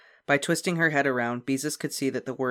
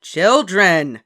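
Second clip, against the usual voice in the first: loud stern call; cry out; 6 semitones higher, 13 dB louder than usual. A narrator's reading. Mother stopping the children, loud call. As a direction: stop the fight. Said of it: "cried Mother".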